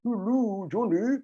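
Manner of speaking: a lighter, tighter-mouthed hello; different character voice. Shown as a demonstration. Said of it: "That's very different to this one".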